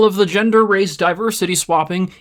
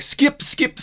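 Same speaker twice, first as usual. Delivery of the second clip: "skip, skip" yelped much higher, hammed up; "skip, skip" flung out high like mashing a remote; impatient skipping; 12 semitones higher than usual. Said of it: "you're like".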